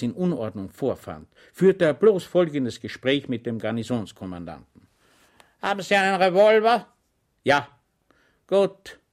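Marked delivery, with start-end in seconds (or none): 5.6-9.0 higher, louder contemptuous general; harsh inspecting general. Acted. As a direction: sneer at the commander with icy menace. The dreaded general's inspection speech.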